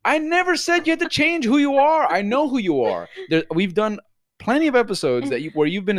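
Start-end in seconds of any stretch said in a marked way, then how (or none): 0.0-3.1 much higher, louder incredulous outburst; exasperated yell. Retold or imitated. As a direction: explode in disbelief at cohost. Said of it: "And I'm like".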